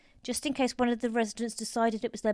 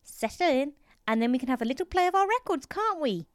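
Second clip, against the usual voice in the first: higher, coaxing nurse voice; ingratiating; 6 semitones higher than usual. A narrator's reading. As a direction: coax an old patient along. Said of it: "She smiled ingratiatingly at him".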